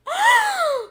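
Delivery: sharp high gasp of fright; startled gasp. Retold or imitated. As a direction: gasp like you just got jumped. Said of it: "And I'm like".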